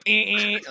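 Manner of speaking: high, loud buzzer imitation, quick; bell ringing twice. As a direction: buzz it out like an insistent bell. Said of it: "like, twice fast".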